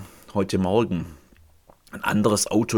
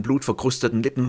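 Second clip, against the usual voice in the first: usual speaking voice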